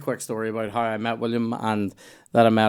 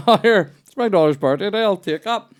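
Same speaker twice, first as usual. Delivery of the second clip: high, bright put-on shopper voice, louder; mock customer voice; 8 semitones higher, 4 dB louder than usual. Friend mimics an impulsive shopper, higher put-on voice. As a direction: play a carefree shopper grabbing a shirt. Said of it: "You're like".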